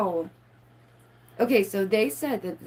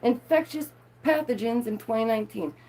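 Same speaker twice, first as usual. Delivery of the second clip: ordinary talk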